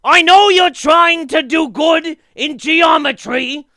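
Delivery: high, very loud, drawn-out squawk; Gilbert Gottfried. Acted. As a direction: abrasive shouted announcement in a screechy put-on voice. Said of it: "like Gilbert Gottfried being like".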